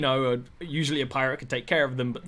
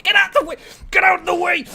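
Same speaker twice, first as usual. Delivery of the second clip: shouting; 6 dB louder, faster than usual. Retold or imitated. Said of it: "she's like".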